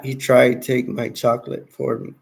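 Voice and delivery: usual speaking voice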